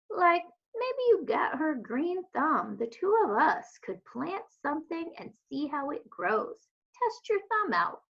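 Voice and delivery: a lightly voiced old lady inviting her to try; encouraging old woman.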